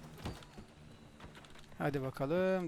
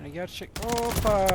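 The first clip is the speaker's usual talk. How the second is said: ordinary talk, nothing marked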